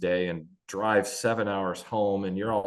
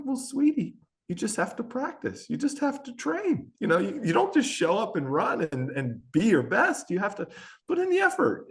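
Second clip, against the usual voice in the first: much higher, choked-up fatherly coaching; emotional dad coaching his girl; fatherly advice; 10 semitones higher than usual. Retold or imitated. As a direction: give gentle dad advice through tears of pride. Emotional dad advising daughter.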